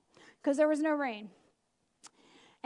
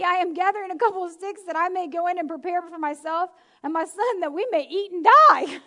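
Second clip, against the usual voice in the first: ordinary talk, nothing marked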